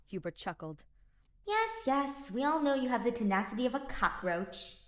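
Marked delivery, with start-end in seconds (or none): 1.5-4.8 much higher, louder dismissive yes yes; snorting dismissal. Acted. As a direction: brush him off with a snort. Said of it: "she snorted".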